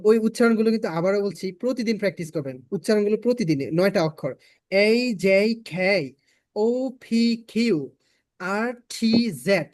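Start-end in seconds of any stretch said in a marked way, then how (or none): 4.7-9.7 very slow, drawn-out English letter names; careful letter pronunciation. Shown as a demonstration. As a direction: model each letter for a class, stretched long. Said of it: "এই নয়টা অক্ষরের উচ্চারণগুলো".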